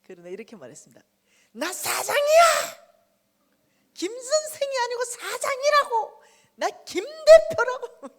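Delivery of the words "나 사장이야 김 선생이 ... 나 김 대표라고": much higher, much louder, slow furious scream; wounded pride yelling I'm the boss; screaming rant. Retold or imitated. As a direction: scream it alone at the altar. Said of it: "강대상에서 난리가 났습니다".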